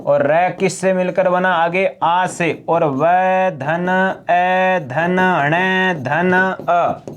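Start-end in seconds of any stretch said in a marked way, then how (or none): 2.9-7.1 very slow spelling out. Shown as a demonstration. Teacher spelling out word parts.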